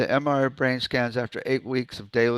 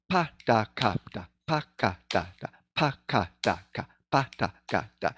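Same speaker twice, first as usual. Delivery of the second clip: quicker, steady syllable drill; speeding up; 2 semitones lower than usual. Shown as a demonstration. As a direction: rattle the syllables off in a brisk, even rhythm. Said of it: "then you start speeding up".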